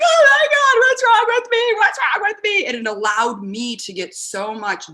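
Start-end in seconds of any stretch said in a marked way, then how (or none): 0.0-2.6 high, loud wailing self-doubt; high-pitched hammed-up despair; mock anguish. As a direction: wail like you're falling apart. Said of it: "And I'd just be like".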